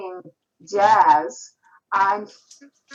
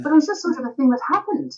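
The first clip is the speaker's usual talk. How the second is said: quick, higher Irish accent; 6 semitones higher, faster than usual. Shown as a demonstration. Explaining accent slips to the host, in a quick Irish accent. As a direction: finish the thought fully in an Irish lilt. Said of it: "speaking like this".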